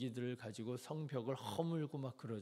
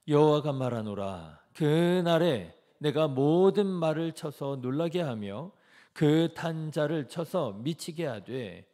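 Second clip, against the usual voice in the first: scripture reading; 2 semitones higher, 13 dB louder than usual. Acted. Leads congregation in reading verse.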